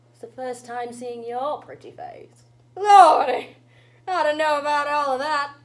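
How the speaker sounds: put-on old witch. Granny meets the newcomer, theatrical old witch. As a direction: muse wistfully as an old witch. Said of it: "an old witch".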